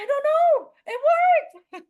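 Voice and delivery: high, loud gleeful cry; thrilled disbelief. Retold or imitated. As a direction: squeal it with delight. Retelling the car starting.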